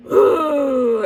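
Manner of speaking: high, loud gushing "ooh"; a long ooh sliding down from high; exclamatory ooh. Real, not acted. Reviewer thrilled by a movie's ending, exclamatory ooh. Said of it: "a lot of exclamatory sounds".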